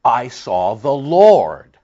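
very slow, loud pulpit declaration; solemn scripture proclamation. Acted. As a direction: draw it out, weighting the last word. Solemnly declaims Isaiah's vision.

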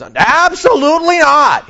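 high, loud, slow parent's refusal; a parent shutting down a kid's request, high and loud; stern parent. Retold or imitated. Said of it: "Like when the parent says to the kid".

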